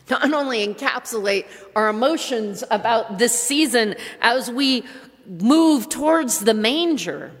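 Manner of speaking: ordinary talk, nothing marked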